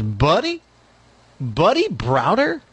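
slow, rising, unsure double take; surprised recognition. Retold or imitated. Kevin Spacey spotting Buddy at dinner, slow surprise. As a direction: ask as if you can't believe it's him. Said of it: "walks Kevin Spacey. And he goes".

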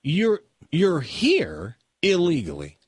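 incredulous. Real, not acted. Mock outrage at the law.

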